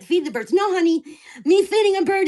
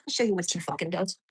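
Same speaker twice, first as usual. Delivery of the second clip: low muttered threat; 8 semitones lower, 8 dB quieter, faster than usual. Real, not acted. Angry rant about neighbor.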